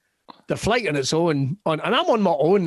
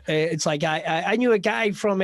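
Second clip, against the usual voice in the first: ordinary talk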